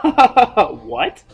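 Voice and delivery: loud, high what through laughter; cackle, then blurt it out high; imitated manic laugh into a question; mock maniacal laughing. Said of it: "started laughing fucking maniacally".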